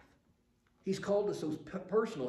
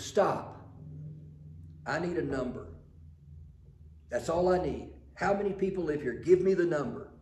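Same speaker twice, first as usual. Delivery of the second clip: impatient demand; 3 semitones higher, 7 dB louder than usual. Retold or imitated. Census man demanding a number.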